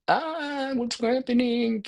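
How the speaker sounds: sudden high-pitched mock alarm; alarmed worry. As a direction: voice jumps way up in imitated panic. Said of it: "when we have that feeling of like".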